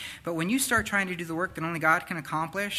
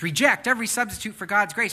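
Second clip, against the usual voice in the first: higher and louder, driving the words home; emphatic preaching; 7 semitones higher, 6 dB louder than usual. Acted. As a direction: preach it with full force. Repeats Paul's words forcefully.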